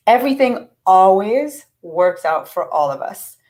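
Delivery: ordinary delivery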